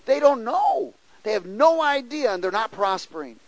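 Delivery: high, loud urgent declaration; the Lord's answer, urgent. Retold or imitated. Preacher voicing the Lord's answer about the bikers, loud. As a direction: proclaim it with rising force. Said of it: "He says".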